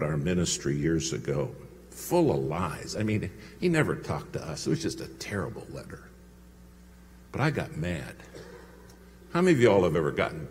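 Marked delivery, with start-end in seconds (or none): 4.7-6.0 whisper. Real, not acted. Aside about the nasty letter.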